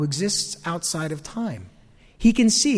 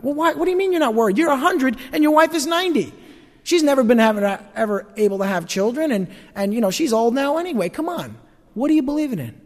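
higher, pressing disbelief; mock incredulous questioning; 7 semitones higher than usual. A skeptic grilling Abraham about his faith, disbelieving. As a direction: needle him like a doubtful neighbor.

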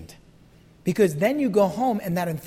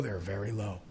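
much lower and softer; dropped low; 12 semitones lower, 12 dB quieter than usual. Shown as a demonstration. Preacher shows the low of emotional Christians, voice sunk low. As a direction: sink the voice down. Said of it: "contrast with very high".

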